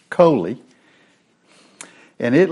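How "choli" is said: coli ending in an ee sound; coli as "KOH-lee". Shown as a demonstration. Teacher repeating the corrected Hebrew word, coli pronounced KOH-lee. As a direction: say coli with a long E at the end. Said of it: "the I's in Hebrew are pronounced as an E".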